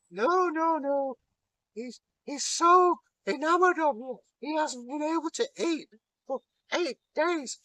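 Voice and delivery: very high fluttery maid voice; Loki, dressed as the maid, makes excuses to the giant in a very high voice; Loki in falsetto maid voice. Acted. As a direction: simper like a coy bridesmaid.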